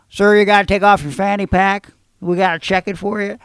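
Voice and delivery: loud, bossy guard voice imitated; mock guard order. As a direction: bark it like a pushy security guard. Security guard ordering him loudly.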